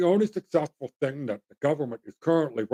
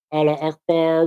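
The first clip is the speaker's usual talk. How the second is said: quoted religious saying. Retold or imitated. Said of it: "the other sayings".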